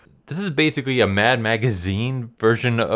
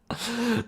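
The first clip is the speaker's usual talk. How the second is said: soft high giggle; 5 dB quieter than usual. Real, not acted. Laughing again.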